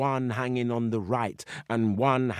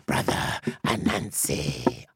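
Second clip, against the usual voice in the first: deep tiger voice; 10 semitones lower, slower than usual. Acted. Brother Tiger's voice.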